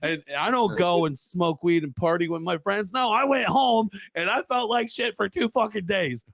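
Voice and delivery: high-pitched, worked-up comic complaint; exasperated storyteller's protest; indignant rant. Real, not acted. Indignant contrast with everybody's fun.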